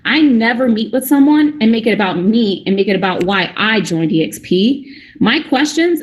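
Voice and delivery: ordinary talk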